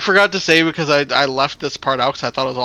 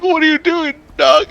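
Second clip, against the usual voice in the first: much higher, loud disbelieving question; incredulous; 11 semitones higher, 5 dB louder than usual. Retold or imitated. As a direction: squeak it out in total disbelief. Retold disbelief at friend's plan.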